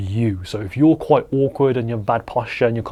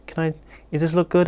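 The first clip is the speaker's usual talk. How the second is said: higher-pitched, hesitant mock voice; timid asking; 5 semitones higher, 3 dB quieter than usual. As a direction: seek approval like an unsure photographer. Mimics passive, unsure photographer.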